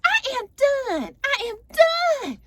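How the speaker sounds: mock Portia done. Portia cracking up at Kenya's stunt, high drawn-out voice. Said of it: "she's laughing on the floor".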